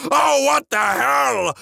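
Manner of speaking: high, slow, drawn-out shout; dad's yell. A narrator's reading. Dad bursting in on screaming son, shocked yell. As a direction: yell it in stunned outrage, stretching the words. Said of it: "He yells".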